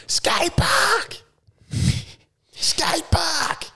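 very high, strained yell at ordinary volume; mock singer's yell. Host imitating a lead singer on stage, strained high mock yell. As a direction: imitate a band frontman yelling the hook. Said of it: "He's just up there yelling".

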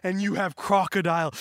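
ordinary delivery

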